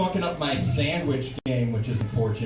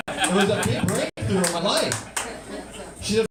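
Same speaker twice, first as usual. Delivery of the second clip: usual speaking voice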